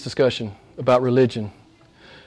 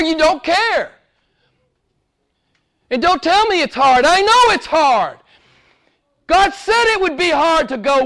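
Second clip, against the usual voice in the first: full-force preaching; 10 semitones higher than usual. Real, not acted. Preacher pleading with congregation, impassioned preaching. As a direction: preach like the church is on fire. Preacher escalates about love, truth.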